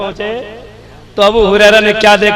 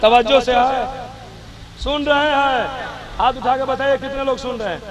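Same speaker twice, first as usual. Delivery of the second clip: much softer aside to the crowd; hushed check-in; 2 semitones higher, 13 dB quieter than usual. Real, not acted. Preacher asking who is listening, suddenly quiet aside. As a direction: drop right down and check they are listening.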